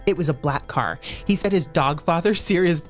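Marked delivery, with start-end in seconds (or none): none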